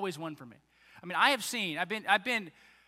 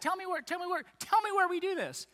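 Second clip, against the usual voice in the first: much higher, singsong asking; playful high urging; 11 semitones higher than usual. Retold or imitated. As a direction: tease it out like nudging a friend. Jesus pressing, very high.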